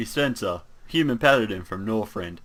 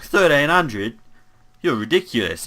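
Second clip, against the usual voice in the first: higher, louder disbelief on THIRTEEN HUNDRED; incredulous retort; 4 semitones higher, 4 dB louder than usual. Acted. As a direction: balk loudly at the salesman's price. Haggling over salesman's price.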